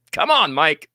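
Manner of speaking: ordinary delivery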